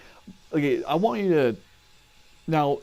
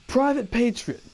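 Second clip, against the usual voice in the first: drill sergeant; 9 semitones higher than usual. Retold or imitated. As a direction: call out the recruit's name in formation. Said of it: "He goes".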